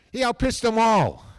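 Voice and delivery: emphatic praise. Real, not acted. Praising the little lefty.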